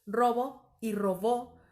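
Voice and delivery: robo stressed on the first syllable, robó on the last, said slowly; slow stress contrast. Shown as a demonstration. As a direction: spell out the shift from RO-bo to ro-BÓ. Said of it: "la única diferencia es el acento". (contraste lento: RObo y roBÓ)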